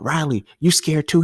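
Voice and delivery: high taunting voice; mocking question. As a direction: pitch up and tease him. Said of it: "And he was like".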